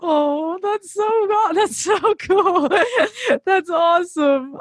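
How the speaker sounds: real excited squeal; excited gushing. Real, not acted. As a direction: gush with giddy delight. Gushing with delight.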